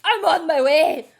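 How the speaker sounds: a tipsy barfly hurrying off for her moonshine; eager drunk Grena. Acted. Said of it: "and she's like".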